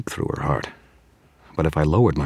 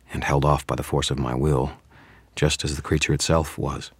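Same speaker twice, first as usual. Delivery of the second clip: ordinary delivery, nothing marked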